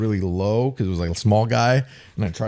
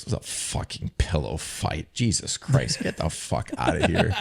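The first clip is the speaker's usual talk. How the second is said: mock whispered scold; 5 semitones lower, 5 dB quieter than usual. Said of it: "he goes".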